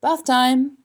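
high, loud, jolly announcement; cheerful call. A narrator's reading. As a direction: sing out cheerfully to everyone. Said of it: "said Zuckerman cheerfully".

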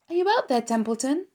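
put-on a young pig calling out for his friend the rat. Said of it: "called Wilbur".

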